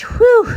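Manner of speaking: high winded puff of air; breathless whew. Real, not acted. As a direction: blow out a tired whew. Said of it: "out of breath".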